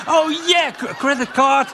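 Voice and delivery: high, loud credit card credit card; mock frantic. Comic mocking himself grabbing his credit card, high and loud. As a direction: scramble for the card eagerly.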